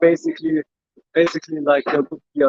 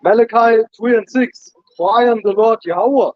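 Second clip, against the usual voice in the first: raised, louder verse reading; scripture reading; 6 semitones higher, 3 dB louder than usual. Acted. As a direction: read the verse out to the group. Reads scripture aloud on request.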